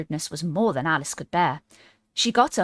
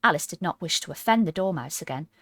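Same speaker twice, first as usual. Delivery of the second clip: ordinary delivery, nothing marked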